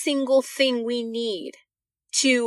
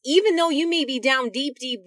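normal talking voice

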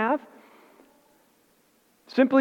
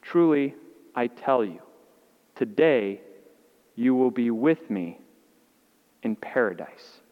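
tender promise; 5 semitones lower than usual. Acted. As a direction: speak it gently as a promise of comfort. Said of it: "Jesus answered him".

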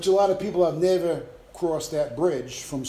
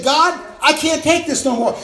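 loud despairing cry to God, high; 7 semitones higher, 6 dB louder than usual. Retold or imitated. A believer crying out to God, loud and high. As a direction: throw the words up at God.

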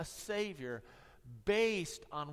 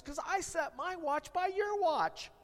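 high, squeaky panicked reveal; a comic shopkeeper, much higher; aghast watchmaker; 12 semitones higher, 4 dB louder than usual. Acted. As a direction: blurt the reveal in dismay. Joke punchline, watchmaker's reveal.

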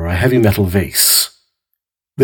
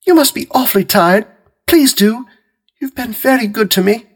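much higher, gentle housewife voice; Mary Maloney; 14 semitones higher, 4 dB louder than usual. A narrator's reading.

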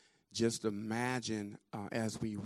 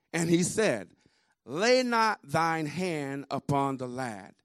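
raised, weighty scripture reading; slow, energetic projection; 5 semitones higher, 6 dB louder than usual. Real, not acted. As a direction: proclaim it slowly in a high, projected voice. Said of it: "slowly with energy and project".

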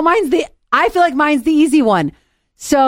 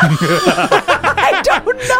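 high, stretched-out words through laughter; real giggling fit; laughing; 8 semitones higher, much slower than usual. Real, not acted. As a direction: laugh helplessly while answering. Cracking up at the question.

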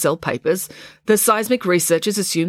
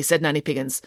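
usual speaking voice

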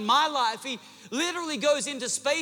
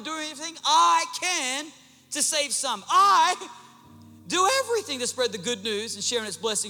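preaching at full force; 3 semitones higher than usual. Acted. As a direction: preach it out over the congregation. Preacher builds, reads scripture aloud.

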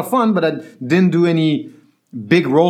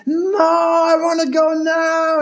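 very high tantrum wail; crying child; 13 semitones higher than usual. Retold or imitated. Nine-year-old refusing to wait, mock crying. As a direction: imitate a bawling, insistent kid. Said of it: "he starts crying".

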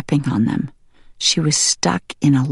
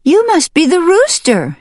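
high, loud exclamation; crying out; 12 semitones higher, 5 dB louder than usual. Acted. Hen to her unseen rescuer, crying out. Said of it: "she cried".

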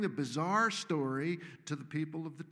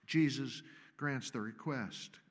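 ordinary talk, nothing marked

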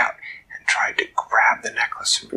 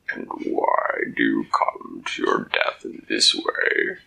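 much lower, slow, hollow monster voice; deep kraken voice; 12 semitones lower than usual. Acted. Kraken mocks Dak for coming to die, low slow monster voice. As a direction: speak like a vast creature from the deep. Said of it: "A deep, burbling voice said".

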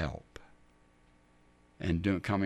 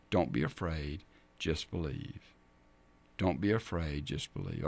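soft reassurance; 6 semitones lower, 7 dB quieter than usual. Acted. Jesus calming Jairus after bad news, soft and reassuring. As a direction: lower and softer, calm comfort. Jesus comforts the grieving father.

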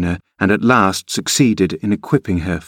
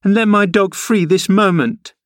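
put-on very high, imperious girlish order; exclaimed; 12 semitones higher than usual. A narrator's reading. Girl ordering the woman to free her dog, indignant. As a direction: demand it at once, indignantly. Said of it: "she exclaimed".

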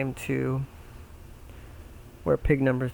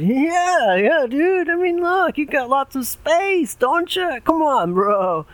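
frat bro; 13 semitones higher, 5 dB louder, slower than usual. Acted. Pig two pleads.

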